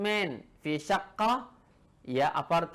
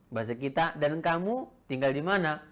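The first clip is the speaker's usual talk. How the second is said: ordinary talk, nothing marked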